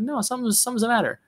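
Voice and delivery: gently pry like a knowing mother; mom's probing voice. Retold or imitated. Mom probing her son.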